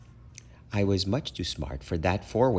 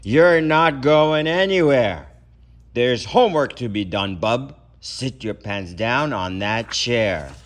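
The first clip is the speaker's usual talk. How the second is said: loud, slow, bossy order; tough hunch; 2 semitones higher, 6 dB louder than usual. Acted. Tough hunch orders the boy to his homework. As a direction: lay down the law like a tough boss. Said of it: "a real tough hunch informed me".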